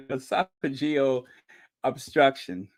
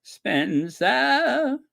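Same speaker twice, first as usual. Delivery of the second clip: high, drawn-out mock actress call; hammed-up old-Hollywood impression; Katharine Hepburn; 10 semitones higher than usual. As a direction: stretch the name upward, dropping the final R. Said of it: "He sounds like Katharine Hepburn".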